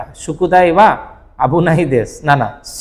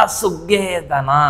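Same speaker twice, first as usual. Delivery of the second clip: "সুগে" drawn out slowly with mock pressure; pressed sarcastic sugee; 3 semitones higher, 4 dB quieter than usual. Shown as a demonstration. Teacher demos anime-style সুগে with pressure. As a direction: lean on "সুগে", a little sarcastic. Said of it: "একটুখানি বেশি pressure দিয়ে".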